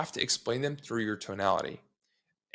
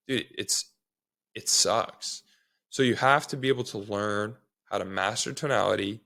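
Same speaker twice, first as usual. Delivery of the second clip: usual speaking voice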